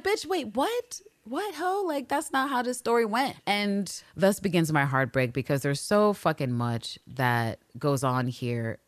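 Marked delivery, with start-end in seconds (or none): 0.0-3.4 high-pitched disbelieving bitch wait what; Villanelle, high and incredulous. Retold or imitated. Villanelle scoffing at Eve in a high voice. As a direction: sass her back in a high squeal of disbelief. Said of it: "And Villanelle's like".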